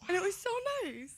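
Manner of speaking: high, tearful little squeak; getting emotional. Real, not acted. Daughter touched by dad's visit, high and tearful. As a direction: voice climbing as real tears come. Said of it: "I don't know why I'm getting so emotional".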